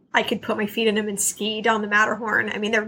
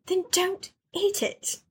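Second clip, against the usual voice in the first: deadpan mom; 6 semitones higher, 4 dB quieter, slower than usual. Retold or imitated. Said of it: "the mom just looks at her for a minute and goes".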